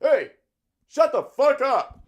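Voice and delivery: high, slow, strained yell without full volume; aggressive mock yell. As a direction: imitate an aggressive drunk yelling across tents. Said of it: "real aggressive".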